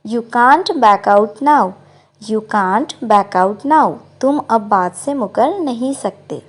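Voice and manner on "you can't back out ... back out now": very slow, careful English model line; slow model sentence. Shown as a demonstration. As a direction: say each word slowly for learners. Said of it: "इसको English में कहते हैं". (धीरे-धीरे साफ़ बोला गया वाक्य)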